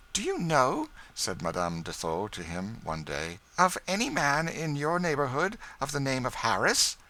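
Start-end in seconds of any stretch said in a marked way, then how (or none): 0.1-0.9 higher, eager lady's voice; a curious lady asking, pitched up; Madame Desault. A narrator's reading. 3.6-7.0 lightly higher lady's voice; Madame Desault. A narrator's reading.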